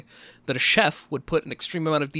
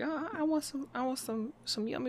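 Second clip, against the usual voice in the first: put-on high, soft childlike voice, much higher than usual; 10 semitones higher, 10 dB quieter than usual. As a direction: squeak it out like a small boy asking for a treat. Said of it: "great [name] impression".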